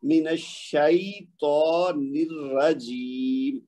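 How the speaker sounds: "من الشیطان الرجیم" very slow, every syllable drawn out; correct recitation demo. Shown as a demonstration. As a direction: model الشیطان with "shai" and long aa. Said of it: "اس کو ہم نے improve کرنا ہے".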